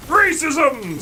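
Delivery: high, drawn-out mock cry; hammed-up cry of outrage; outraged accuser. Gamer imitating viewers who clip him, mock outrage. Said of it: "they're gonna be like".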